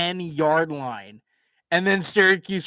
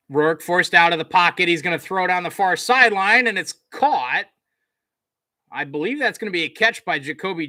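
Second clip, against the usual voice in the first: brisk, punchy sportscaster call, louder on the big moments; live play-by-play; 4 semitones higher, 6 dB louder than usual. Acted. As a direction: call the return like a TV announcer. Calls the game play-by-play.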